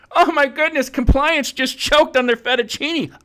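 very high, loud mock gasp; mock alarm. Joking to viewers about compliance, high mock alarm. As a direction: fake panic about compliance choking.

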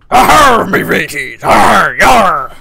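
hammed-up pirate growl, loud. Gamer shouting in pirate voice while playing. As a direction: ham it up like a cartoon pirate. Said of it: "I'm sounding like a fucking pirate".

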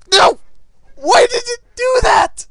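very high, loud wailing protest; anguished shout. Real, not acted. Player asking why after losing, anguished shout. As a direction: shriek it like a sore loser.

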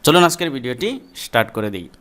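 ordinary talk

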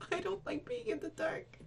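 high timid voice in the dark; frightened. Real, not acted. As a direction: whimper it high. Scared in dark elevator.

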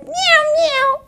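high-pitched kitten meows; little kitten. Acted. Kittens answering their mother, high little mews. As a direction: mew like a tiny kitten, up high. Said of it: "And the little kitten said".

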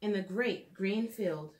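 lilting storybook read-aloud; gentle storytime narration for little listeners; reading to children. Acted. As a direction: read it like a bedtime picture book. Reads picture book to children.

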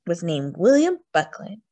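ordinary delivery, nothing marked

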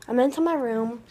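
ordinary delivery

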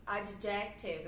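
slow ad-JEC-tive, middle syllable stressed; stress on second syllable. Shown as a demonstration. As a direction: say adjective slowly, weight on JEC. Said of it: "inclusive até professores também falando dessa forma".

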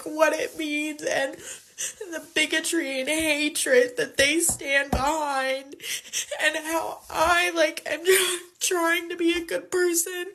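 tearful, slow, breaking voice; fighting back tears, words catching; crying while talking. Real, not acted. Young woman crying to camera about her parents' vote. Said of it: "Forgive me for being a little emotional here".

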